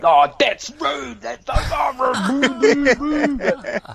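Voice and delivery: high, slow shocked tutting; indignant crowd. Retold or imitated. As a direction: play offended Irish fans. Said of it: "I heard people like".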